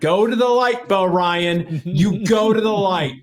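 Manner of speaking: high, mock-dramatic send-off; mock urging. Fan sending rival coach to the light, mock urging. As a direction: egg him on with hammed-up urgency.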